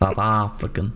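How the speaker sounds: mild South African imitation; light go at a South African accent; attempted South African accent. Shown as a demonstration. Demonstrating for a friend, attempted South African accent. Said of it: "Something like that".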